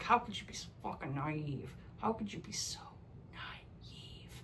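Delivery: hushed exasperation. Retold or imitated. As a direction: let the scolding fade into a whisper. Scolding a naive heroine.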